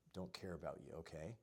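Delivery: calm mirroring of the wife's complaint, softly; calm quiet reflecting back. Shown as a demonstration. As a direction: repeat it back gently and quietly. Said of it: "you could reflect and just repeat".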